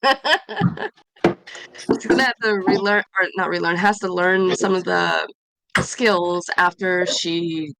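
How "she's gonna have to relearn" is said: laughing. Real, not acted. Laughing after the yawn.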